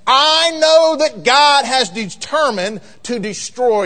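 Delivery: weighty, drawn-out pulpit declaration; prophetic pronouncement. Acted. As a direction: proclaim doom on the king.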